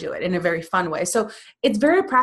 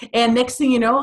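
normal talking voice

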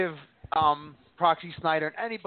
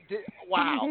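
stunned wow; 9 semitones higher, 4 dB quieter than usual. Retold or imitated. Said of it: "I was just like".

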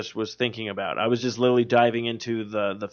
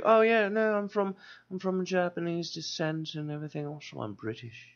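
a light mock of a breezy director, fading much softer; imitating James Wan; 4 semitones higher, 8 dB quieter than usual. Retelling to cohost how the director talks, light mock voice. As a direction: toss off the British reveal casually, trailing away. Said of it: "He's like".

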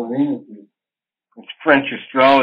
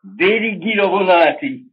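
Italian; 6 semitones higher than usual. Retold or imitated. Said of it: "where he says".

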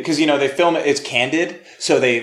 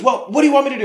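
higher, fed-up grumble; exasperated; 11 semitones higher, much faster than usual. Retold or imitated. Grandpa answering the kid at the ride, mild exasperation. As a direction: shrug it off irritably. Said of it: "he goes".

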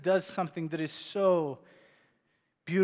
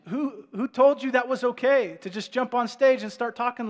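higher, louder mock indignation; indignant challenge; 5 semitones higher, 7 dB louder than usual. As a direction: challenge the intruder as a stunned onlooker. Imagined congregation challenging an intruder.

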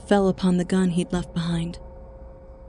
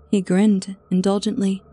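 plain reading voice

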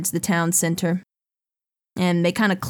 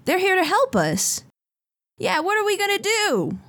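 worried villagers; 11 semitones higher than usual. Acted. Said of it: "Some people say".

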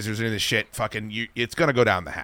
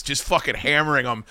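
ordinary talk

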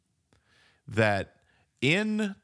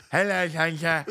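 higher, louder mock-British child voice with a laugh; heavier British accent; 8 semitones higher, 4 dB louder than usual. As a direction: lay the British accent on thicker. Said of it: "No, no, more accent".

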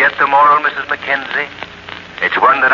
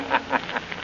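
eerie laugh; 11 semitones higher, 12 dB quieter than usual. Acted.

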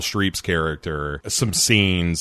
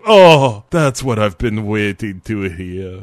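loud gasping 'Oh' then a hammed-up purr; a loud over-the-top gasp of delight; mock ecstatic Dracula; 6 dB louder than usual. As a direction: throw your head back in mock bliss. Mock Gary Oldman's delight.

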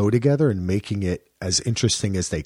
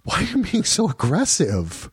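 much higher whiny imitation; imitating a wife who keeps calling him aggressive; mock accusing wife; 10 semitones higher than usual. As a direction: parody her offended question. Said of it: "That's what she kept saying".

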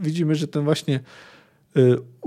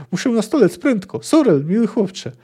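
put-on high, plaintive old man begging for help; pitched-up pitiful appeal; whiny urgency; 7 semitones higher than usual. A narrator's reading. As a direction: moan it pleadingly, in a hurry. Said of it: "oznajmił jękliwie".

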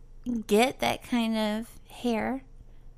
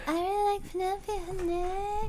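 high, fast and soft, crooning; a lightly voiced amorous skunk; Pepe Le Pew voice; 6 semitones higher, 5 dB quieter than usual.

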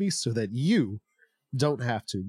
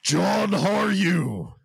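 booming slow villain greeting; barbarian; 5 semitones higher, 4 dB louder than usual. Acted. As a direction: call it out like a warlord. Voice from outside the station.

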